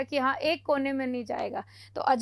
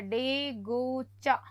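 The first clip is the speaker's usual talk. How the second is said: डेगोचा said very slowly and softly; slow, quiet word demo; 4 semitones lower, 5 dB quieter, much slower than usual. Shown as a demonstration. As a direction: spell out डेगोचा gently for the class. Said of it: "डेगोचा और आता है".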